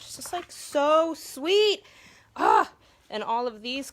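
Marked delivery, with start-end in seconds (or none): 0.0-1.8 high, loud squeal of delight over the card; gushing delight. Real, not acted. Gushing over a fan's sweet handmade card, high delighted squeal. Reading kind handmade cards aloud. 2.3-2.7 short, high, loud "Ah!" squeal of joy; a burst of happy squealing; delighted squeal. Real, not acted. Overwhelmed by a kind card, loud happy squeal. Reacting to sweet cards.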